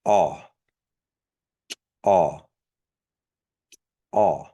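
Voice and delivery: aw said as t'aw with a popped t; ejective T. Shown as a demonstration. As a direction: pop the t of aw. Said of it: "And then the ejective T".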